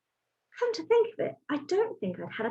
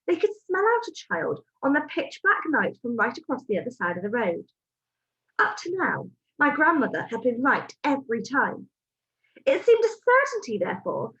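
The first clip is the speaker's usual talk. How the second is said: ordinary delivery, nothing marked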